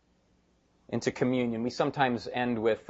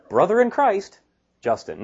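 mock email sign-off; 8 semitones higher, 6 dB louder than usual. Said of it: "you know, not like".

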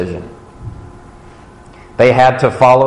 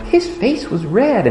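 put-on higher, slow wide-eyed kid; 10 semitones higher, 3 dB quieter, slower than usual. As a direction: play a shocked little kid. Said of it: "And the other kid's going".

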